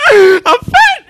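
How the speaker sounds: high, loud, tearful wail; hammed-up sob of dismay; tearful cry. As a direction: wail it at your own reflection. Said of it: "look at yourself in the mirror and go".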